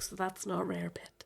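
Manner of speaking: hushed sarcastic aside; whispered aside. Retold or imitated. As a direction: whisper it under your breath. Retorting to customer under breath.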